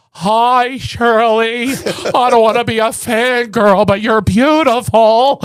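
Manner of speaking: high, loud, gushing and slow; trembling fangirl greeting; starstruck fan. Retold or imitated. Son imitating mother meeting Shirley Jones, high and gushing. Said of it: "She goes".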